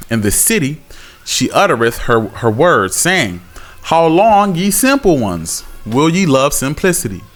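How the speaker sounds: measured, solemn Bible reading; scripture reading. Acted. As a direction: read the verse like a preacher. Reads Proverbs aloud.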